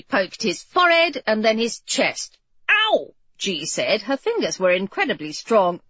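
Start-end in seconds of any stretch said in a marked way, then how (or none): 2.7-3.1 sharp high yelp of pain. Acted. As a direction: cry out as if poked hard. Poked hard in the forehead.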